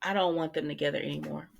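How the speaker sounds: ordinary talk, nothing marked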